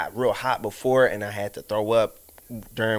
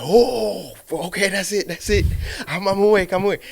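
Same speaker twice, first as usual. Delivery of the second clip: jolted awake; 6 semitones higher than usual. Retold or imitated. Cold shower jolts him awake.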